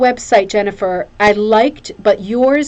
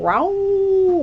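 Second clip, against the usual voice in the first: high imitated dog howl; 11 semitones higher than usual. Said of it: "Mary Jo, going".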